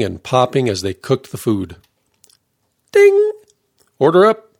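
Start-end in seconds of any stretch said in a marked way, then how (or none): 2.9-4.5 high ringing ding, then a called-out order; line cook's yell. A narrator's reading. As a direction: call out like a busy short-order cook. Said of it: "they'd yell to summon the waitresses".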